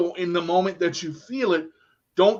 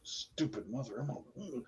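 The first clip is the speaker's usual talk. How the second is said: low cursing under the breath; acted private grumble, barely audible; muttered resentment; 5 semitones lower, 18 dB quieter than usual. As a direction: mutter it so he can't hear. Muttered resentment behind the polite greeting.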